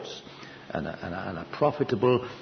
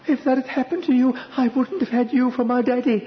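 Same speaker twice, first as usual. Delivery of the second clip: put-on high little child's voice, a bit louder; 5 dB louder than usual. Preacher's child protesting to father, high childlike voice. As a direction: play the small child, high and earnest. His child quoted, tearful.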